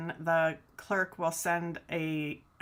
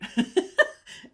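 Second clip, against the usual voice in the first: breathy giggle; 12 semitones higher, 7 dB louder than usual. Real, not acted. Laughing at backwards sticker.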